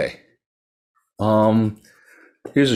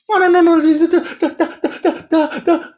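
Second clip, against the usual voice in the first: much higher, louder, stuttering; can't get the words out; panicked stammer; 5 dB louder than usual. Acted. Terrified merchant stammers to the soba seller, high panicked.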